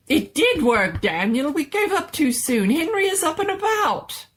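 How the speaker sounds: high, loud, thrilled scientist voice; excited mad doctor. Acted. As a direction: crow with triumph, pitch climbing.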